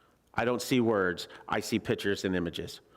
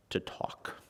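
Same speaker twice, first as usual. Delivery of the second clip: whispered; 9 dB quieter than usual. Acted. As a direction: drop to a breathy whisper on the last words. Dramatic pause before punchline.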